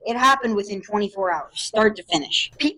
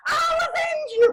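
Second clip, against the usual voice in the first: high, strained heroic cry; dramatic vow. Acted. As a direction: swear vengeance in a high voice. Friend vowing revenge.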